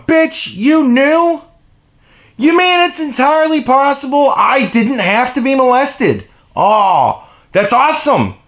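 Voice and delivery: high, loud sarcastic outburst as an imagined victim; outraged sarcasm. Acted. Imagined victim to someone who knew and stayed silent, outraged sarcasm. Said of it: "imagine them sitting there going".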